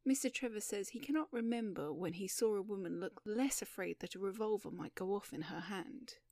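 usual speaking voice

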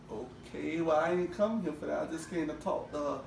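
very soft, low acted reply; low-key, hushed retort; subdued reply. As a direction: drop it right down, quiet and unbothered. Retold awkward reply to sister's insults.

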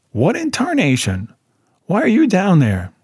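put-on high, crusty old caretaker; crusty old-timer, surprised; old man. A narrator's reading. Old caretaker finding boys in a grave, surprised.

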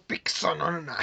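"Pizzonona" said slowly as pik-so-NO-na; added k sound. Shown as a demonstration. Joking with friend about saying "Pizzonona" with a k. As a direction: stretch "Pizzonona" with a playful k before the s. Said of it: "Yo digo Pizzonona".

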